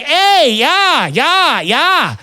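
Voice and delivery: high, sing-out fake Canadian accent; put-on Canadian accent. Diner humoring the tourist, high fake Canadian accent. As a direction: agree in a hammed-up Canadian accent. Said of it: "laid the maple syrup on thick".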